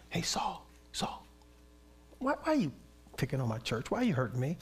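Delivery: soft, hushed pleading; gentle God voice. Pretend. God gently pleading with Saul, hushed and soft. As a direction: softly ask Saul why he hurts the church. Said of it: "I wonder if he said".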